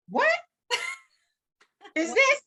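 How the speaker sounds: retold stunned laugh at the phone; laughing disbelief. Retold reaction to record offer.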